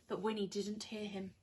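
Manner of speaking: ordinary delivery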